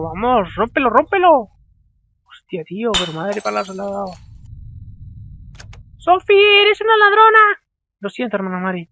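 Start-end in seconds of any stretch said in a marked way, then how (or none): 6.0-7.6 shouted high and loud, furious; nun yelling at a thief; angry accusation. Acted. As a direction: scold her like a nun catching a thief. Reading the nun's angry line.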